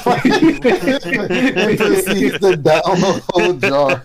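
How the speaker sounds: can't stop laughing while telling it. Real, not acted. Friend cracking up over Pooh's honey, slowed by laughter.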